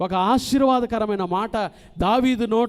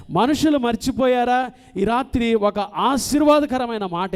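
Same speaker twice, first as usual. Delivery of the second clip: fervent preaching; 2 semitones higher, 6 dB louder than usual. Acted. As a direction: hammer each line home to the flock. Preaching with slow repetitions.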